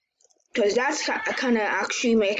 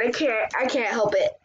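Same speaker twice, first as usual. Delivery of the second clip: ordinary talk, nothing marked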